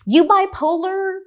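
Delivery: high, loud, slow scolding judge's jab; mock judge scolding two lawyers, loud and high; exasperated judge. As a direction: bark it out as a fed-up judge. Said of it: "some comment like".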